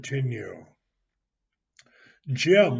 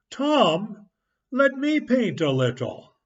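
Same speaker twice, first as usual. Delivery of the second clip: much higher, pleading; eager asking; 8 semitones higher, 4 dB louder than usual. Acted. Ben begging Tom for a turn, eager and higher. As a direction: beg eagerly to join.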